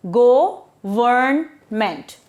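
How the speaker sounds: Go-vern-ment in three slow, separate syllables. Shown as a demonstration. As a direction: say Go-vern-ment slowly with a pause after each syllable. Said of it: "it has again three syllables".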